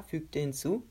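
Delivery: plain reading voice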